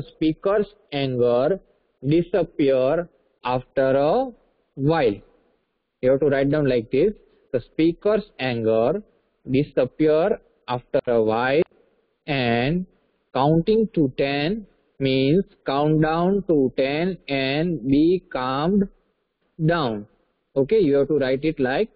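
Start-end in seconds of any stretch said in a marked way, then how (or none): 0.0-5.2 slow phrases, held open between pauses; slow dictation. Real, not acted. Teacher giving students the answer to write down, slow dictation. 7.5-20.1 very slow, phrase by phrase with rising pauses; slow dictation. Real, not acted. Teacher showing students what to write down, very slow dictation.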